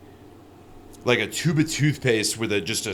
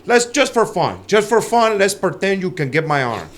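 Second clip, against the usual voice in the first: lightly imitated Brazilian accent, higher; 8 semitones higher than usual. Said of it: "with having an accent".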